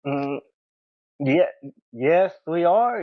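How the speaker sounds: slow, halting answer with long pauses; retold hesitant reply. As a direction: reenact an intimidated, unsure yes. Retelling his unsure reply.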